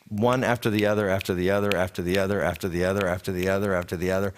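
low, even run of identical phrases; droning repetition. Retold or imitated. Preacher showing verses quoted endlessly from memory, droning repetition. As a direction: reel it off on one low note, without a break.

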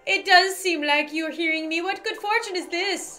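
high-pitched, lilting otherworldly helper; jolly guardian spirit. Acted. Spirit offering Lauren help, jolly high character voice. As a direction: call out as a kindly spirit up high.

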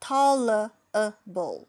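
Tolerable drawn out very slowly, syllable by syllable; very slow teaching model; slow syllable demo. Shown as a demonstration. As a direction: stretch Tolerable out slowly for the learner. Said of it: "ช้าๆ นะคะ".

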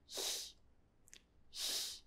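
lone, quiet hissed ich-sound; soft front ch. Shown as a demonstration. German teacher showing ch after consonant or E, I, soft front ch. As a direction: breathe out the ch at the front of the mouth. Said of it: "you need to pronounce it like".

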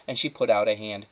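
ordinary delivery

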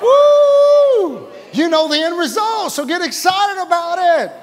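high, loud whoop into a holler; whoop, then rouse the crowd at full voice; fired-up preacher's shout; exultant shouting. Real, not acted. Whoops, urging congregation to get excited.

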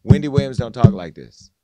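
loud, emphatic, falling hard on talk; loud insistence. Real, not acted. Host to guest, denying the statement, loud and emphatic. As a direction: hammer it home that it isn't her voice. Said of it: "I'm gonna just tell you this".

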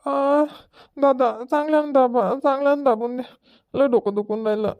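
put-on high, plaintive child's voice; sick child. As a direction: plead like a child with an aching head. Sick child begs for head massage. (आजारी मुलाचा केविलवाणा आवाज)

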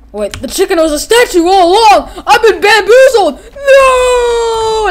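high, loud anguished yell; bamboozled shout. Acted. Toy character screaming at being tricked. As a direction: cry out in shocked betrayal. Character realizes chicken was statue.

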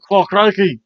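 put-on a light Aussie accent, shouted up high and loud; Australian accent, belting it out like the Crocodile Hunter; Crocodile Hunter impression. Recapping a match, breaking into a light Aussie accent with a loud catchphrase. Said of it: "unless I'm the Crocodile Hunter".

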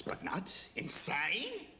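high, rising rhetorical questions; loud, theatrical incredulity; incredulous outrage. Acted. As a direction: throw each word out in disbelief. Reporter's outraged rant.

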